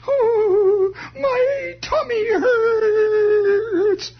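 very high, slow, crying doll wail; tortured doll voice. Retold or imitated. Comedian voicing the doll's cry of pain. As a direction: wail it like a suffering toy doll. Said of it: "a tortured doll voice".

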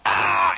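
loud low mock yell of torn corn; corn ear scream. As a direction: bellow the sound of ripping corn. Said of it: "rip it off".

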